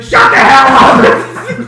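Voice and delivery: loud, slow, high mock yell; angry teacher yell. Teacher finally losing it, loud angry yell. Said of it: "He's like".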